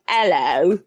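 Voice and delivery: Cockney "Hello" with the H dropped; Cockney accent. Shown as a demonstration. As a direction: greet in put-on Cockney. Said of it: "received pronunciation or Cockney".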